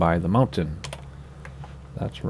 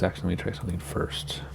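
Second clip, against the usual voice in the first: whispered aside; 2 semitones lower, 10 dB quieter, faster than usual. Real, not acted. Muttered aside while fiddling controls.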